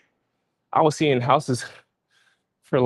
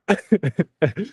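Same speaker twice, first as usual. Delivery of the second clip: laughing; 8 semitones higher than usual. Real, not acted. Laughing at cartel joke.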